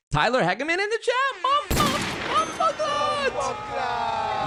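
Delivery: very high, hammed-up fanboy shriek; hyped-up screaming. Streamer spotting a legend in the chat, hammed-up excited scream. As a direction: scream it out like a star just walked in.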